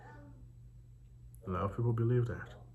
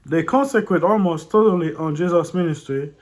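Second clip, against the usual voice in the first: loud, higher read-aloud voice; 8 semitones higher, 13 dB louder than usual. A narrator's reading. Reading a disputed book to viewers, loud read-aloud voice. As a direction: project the text out loud.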